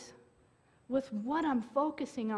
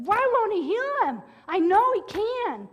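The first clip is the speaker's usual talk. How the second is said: desperate plea; 7 semitones higher, 7 dB louder than usual. Retold or imitated. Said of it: "and I said".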